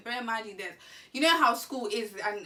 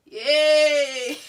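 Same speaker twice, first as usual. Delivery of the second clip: loud laughing cheer; 4 semitones higher, 13 dB louder than usual. Real, not acted. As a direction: whoop through the laugh. Laughing while retelling.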